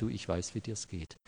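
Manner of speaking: a quiet, tender reassurance; soft comfort. Acted. One who has suffered the same, consoling: quiet, tender. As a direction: hush it down, like a confidence.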